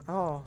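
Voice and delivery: imitated high, forced little chuckle; teacher's awkward courtesy chuckle, imitated. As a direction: mimic a polite, uncomfortable laugh trailing into "oh". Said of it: "the teacher just gave an awkward chuckle".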